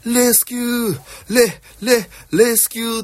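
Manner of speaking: high, projected vocal-drill cry; imitated loud calling. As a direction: mimic a comedian booming out his warm-up. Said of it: "ずっとでかい声で".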